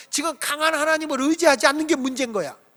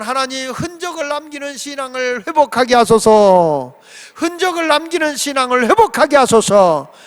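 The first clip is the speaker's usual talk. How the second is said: prayer; 3 semitones higher, 7 dB louder than usual. Real, not acted. Leads congregation prayer.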